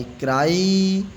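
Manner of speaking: क्राई with the long I drawn out slowly; slow, stretched क्राई; stretched long vowel. Shown as a demonstration. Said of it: "थोड़ा long sound है वाई का।".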